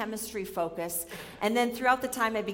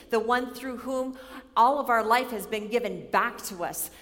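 ordinary talk, nothing marked

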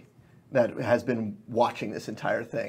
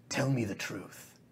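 menacing demand; 8 dB quieter than usual. Acted. Fae grips the pixie.

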